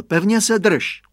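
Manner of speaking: put-on dad telling the boy to hold on tight; firm father. A narrator's reading. Tatínek napomíná synka. (tatínek důrazně napomíná, hlasitěji)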